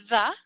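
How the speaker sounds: "da" said with the tongue between the teeth, a short open A; teacher demos "da" for the class; "da" pronunciation. Shown as a demonstration. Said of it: "con una A".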